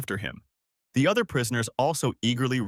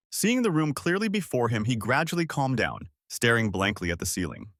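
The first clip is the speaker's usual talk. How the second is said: ordinary delivery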